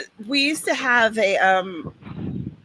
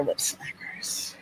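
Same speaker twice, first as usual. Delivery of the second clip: whispering; 15 dB quieter, slower than usual. Real, not acted. Nostalgic aside, voiceless.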